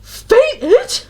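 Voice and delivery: very high and loud, rising shriek; panicked echo through mic. Retold or imitated. Lecturer repeating the news into the mic, loud high shriek. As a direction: yelp it in alarm into the microphone. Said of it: "leans into the ... microphone and just goes".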